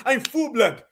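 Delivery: put-on quick parody line in an African accent; mock African accent. Host mimics Tethers claiming full blood, mock African accent. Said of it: "people straight from Africa talking about some".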